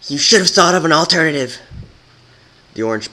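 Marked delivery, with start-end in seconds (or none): 0.1-1.6 high, loud cutting retort; angry retort. Acted. Rebel retorting to Twilight, angry. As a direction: snap the answer back.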